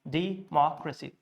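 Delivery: Democracy as "dee, MOCK-ruh-see", split after the first syllable; Democracy in careful pieces, di-MOCK-rasi; divided by syllable. Shown as a demonstration. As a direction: say Democracy with a break before MOCK. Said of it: "divide it based on syllable".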